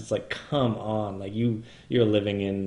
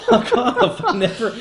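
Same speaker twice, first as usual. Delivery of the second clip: higher and louder, half-laughing fuck off; mock angry dismissal; 11 semitones higher, 6 dB louder than usual. Laughing host telling the Mac updater off, mock anger. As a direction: brush the software off with a laughing snap. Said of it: "Just like".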